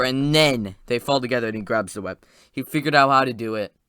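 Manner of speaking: usual speaking voice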